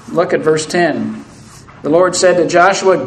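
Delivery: usual speaking voice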